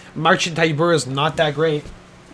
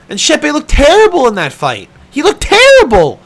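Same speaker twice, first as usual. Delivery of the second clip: loud, higher, rising outburst; heated rant; 11 semitones higher, 9 dB louder than usual. Real, not acted. Ranting to viewers about Shepe's fight, loud and high. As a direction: blow up at how bad it was.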